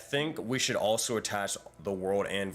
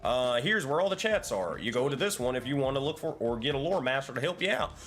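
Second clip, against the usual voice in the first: higher, louder cowboy guide voice; hammed-up cowboy mentor greeting newcomers; 6 semitones higher, 4 dB louder than usual. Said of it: "As long as you do it in that voice".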